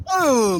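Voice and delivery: a loud, high burst like a lion snapping; mock chomp. Lion devouring the boy's father, sudden loud chomp. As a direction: blurt it like a beast biting.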